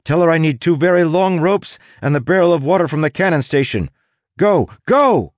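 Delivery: fast, high, urgent shouting; shouted orders. A narrator's reading. Tress sending a crew member running for supplies, shouted urgently. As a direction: shout rapid orders, building to the last word. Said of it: "Tress shouted at her".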